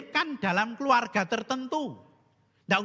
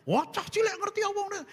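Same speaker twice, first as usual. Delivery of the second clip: father's angry retort; 10 semitones higher, faster than usual. Retold or imitated. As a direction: snap back at the child, pitched high. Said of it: "bapak saya marah, ngamuk". (bapak membentak anak kecil dengan nada tinggi)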